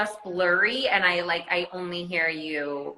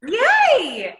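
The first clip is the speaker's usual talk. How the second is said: excited cheer; 8 dB louder than usual. Real, not acted. Celebrating live working.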